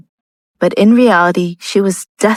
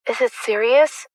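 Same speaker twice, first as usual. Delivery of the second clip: soft, higher, worried question; fearful question; 5 semitones higher, 5 dB quieter than usual. A narrator's reading. Lucia hears that something really happened, fearful. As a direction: ask softly, dreading the answer. Lucia fears bad news about Dalen.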